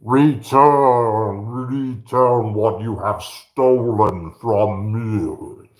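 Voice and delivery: loud, slow, drawn-out movie command; Time Bandits quote. Acted. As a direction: boom it out like a commanding film apparition. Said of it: "Everybody remember Time Bandits?".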